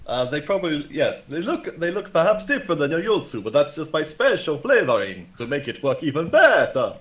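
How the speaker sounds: bluffing sales performance. Acted. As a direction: sell the dud potion with sing-song assurance. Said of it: "give me a performance check to see".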